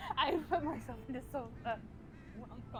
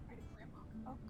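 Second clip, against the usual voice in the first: whisper; 13 dB quieter, faster than usual. Acted. Character whispering.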